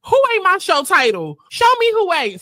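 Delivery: loud, high mock challenge; high-pitched, hammed-up demand; imitating Ferrari. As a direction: call it out loud, mimicking a show host. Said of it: "Ferrari like".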